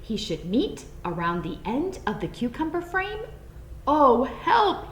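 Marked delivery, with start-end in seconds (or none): 3.9-4.9 a high, louder cry of alarm; frightened gasp. A narrator's reading. Bunny spotting the farmer, frightened high cry. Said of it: "he gasped".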